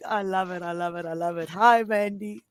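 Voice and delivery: ordinary talk, nothing marked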